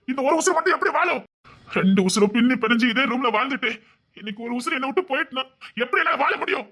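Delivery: high, pleading despair; anguished lament. Acted. Husband laments separation from his wife.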